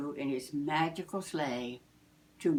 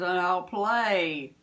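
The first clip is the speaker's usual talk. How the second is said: slow, louder jolly Santa; a busy Santa promising himself a break; 2 semitones higher, 6 dB louder, slower than usual. Acted. Santa to himself, slow jolly voice.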